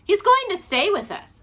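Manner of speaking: high, loud excited announcement; announcing. A narrator's reading. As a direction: proudly announce the news, voice up high. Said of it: "announced Judy".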